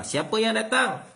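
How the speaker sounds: high, loud call from inside the house; householder calling out. Retold or imitated. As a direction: call out to ask who is at the door. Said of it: "ditanya oleh tuan rumah". (tuan rumah bertanya dengan suara tinggi dan kuat)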